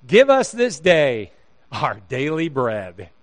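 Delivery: slow, drawn-out mock recitation; mock prayer. Glutton at a cruise feast, mock prayer. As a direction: proclaim it slowly, like a hammed-up prayer.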